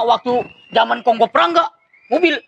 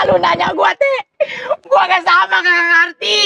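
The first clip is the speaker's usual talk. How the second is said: higher, laughing exclamation; laughing retort; 7 semitones higher than usual. Real, not acted. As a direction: blurt it out between laughs. Laughing outburst at sister.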